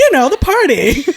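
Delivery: high, loud, laughing mom voice; mom impression. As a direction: push it up high and loud, pressing for an answer. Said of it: "especially if the mom".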